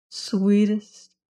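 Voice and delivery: usual speaking voice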